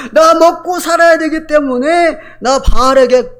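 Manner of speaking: whining excuse. Acted. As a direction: voice the compromiser's excuse. Quoting Israel's compromising widows.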